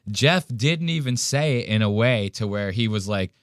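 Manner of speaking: ordinary delivery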